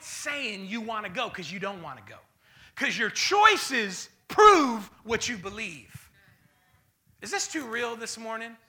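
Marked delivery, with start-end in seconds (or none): 2.7-6.1 drawn out and loud; slow and emphatic. Acted. Preacher hammering the point to the church. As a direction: lay each word down heavily.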